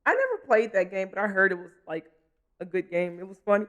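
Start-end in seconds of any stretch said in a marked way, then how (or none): 2.6-3.6 talking through the hiccups; words broken by hiccups. Real, not acted. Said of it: "I have the hiccups".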